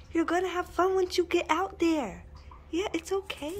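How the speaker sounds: calm the frightened dog gently; soothing pet talk. Real, not acted.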